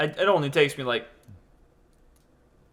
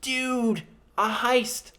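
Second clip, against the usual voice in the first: amazed; 8 semitones higher, much slower than usual. Real, not acted. Amazed reaction to dog theft.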